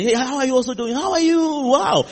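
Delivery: high-pitched, delighted hello; gushing greeting. Acted. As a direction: greet them with a big rising coo. Acted greeting of next member.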